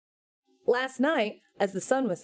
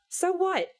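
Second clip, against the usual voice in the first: much higher sharp retort; indignant; 10 semitones higher than usual. Acted. Satan snapping back at God, pitched up. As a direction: bristle at him.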